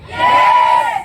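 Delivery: a sudden high cheer; excited yes. Real, not acted. Speaker firing up the seminar crowd about new cars, excited cry. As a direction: hype the audience with a high, bright cheer.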